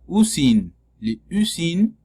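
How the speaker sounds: "usine" with a closed French U; teacher sounding out "usine" with closed U. Shown as a demonstration. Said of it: "entonces es cerrada".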